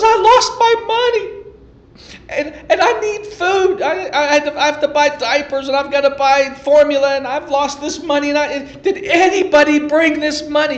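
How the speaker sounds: high, pleading mock-tearful woman's voice; retold sobbing plea of a mother at the checkout; imitating a crying woman. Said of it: "this woman is crying".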